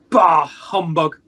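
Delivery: hammed-up miser's growl; mock old grumpy self. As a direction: parody his former sour self. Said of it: "Well, you could say".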